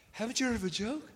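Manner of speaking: high, soft voice rising into a question; mock-innocent high-pitched plea; real high voice. Shown as a demonstration. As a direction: throw up your hands and squeak it quietly. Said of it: "get your voice real high and say".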